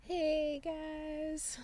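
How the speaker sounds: high, soft stoner greeting; laid-back hippie drawl. Pretend.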